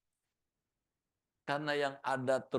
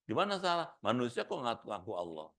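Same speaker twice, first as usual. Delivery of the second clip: ordinary talk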